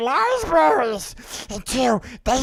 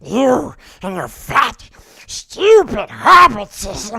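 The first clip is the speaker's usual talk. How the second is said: spiteful Gollum sneer, louder on hobbitses; Gollum impression; 3 semitones lower, 5 dB louder than usual. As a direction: hiss it out like the nasty Gollum. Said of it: "that's my Golem impression".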